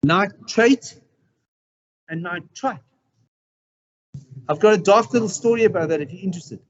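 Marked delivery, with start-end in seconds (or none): 0.0-2.8 nitrate then nitrite, the vowel of the last syllable drawn apart; careful contrast of endings. Shown as a demonstration. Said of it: "There's a difference between nitrate and nitrite".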